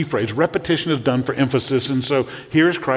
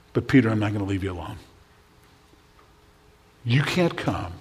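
softer and lower, tender and unhurried; gentle reassurance; 4 semitones lower, 5 dB quieter than usual. Acted. Jesus reassuring Peter he won't be left alone, softly. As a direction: speak as if comforting a friend.

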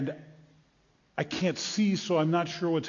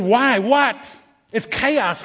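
high, loud startled questions; agitated bewilderment; 6 semitones higher, 6 dB louder, much slower than usual. Acted. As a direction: demand an answer, alarmed. Blind man reacts amid chaos.

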